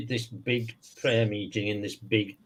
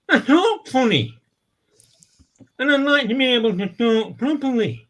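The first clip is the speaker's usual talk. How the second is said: mock voice of a man with a speech impediment; 12 semitones higher, 6 dB louder than usual. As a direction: squeak it out like the guy who talks funny. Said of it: "And the guy goes".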